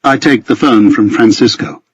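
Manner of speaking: usual speaking voice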